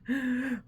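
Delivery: sharp high gasp of alarm; mock panicked gasp. Mimicking a panicked packer, mock gasp. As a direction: gasp like you forgot something. Acting panic over a forgotten item.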